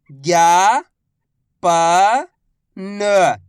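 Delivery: very slow, each syllable drawn out. Shown as a demonstration. Teacher sounding out a word for pupils, slow syllables. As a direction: spell the word out slowly for a child. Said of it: "मैं किस तरीके से".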